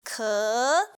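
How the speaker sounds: "Ke" starting low, then climbing; rising tone. Shown as a demonstration. Teacher showing the climb, "Ke" rising. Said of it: "you start low and then climb".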